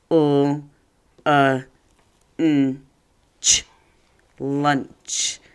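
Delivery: each sound of lunch said separately. Shown as a demonstration. Teacher sounding out lunch with students. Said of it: "let's sound it out together".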